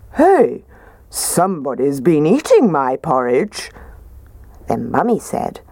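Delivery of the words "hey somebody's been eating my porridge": lightly gruffer papa bear voice; a hint of a gruff father bear; gruff Daddy Bear. A narrator's reading. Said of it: "said in his gruff voice".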